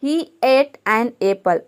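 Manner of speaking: very slow, word by word; deliberate dictation pace; slow example reading. Shown as a demonstration. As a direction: read the sentence out slowly for the class. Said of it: "next sentence देखिए".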